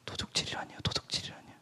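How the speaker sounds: whispered disbelief at the accusation; voiceless, aghast protest; stunned whisper. Retold or imitated. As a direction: breathe it out, offended and stunned. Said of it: "하나님한테 화를 버럭 냈어요".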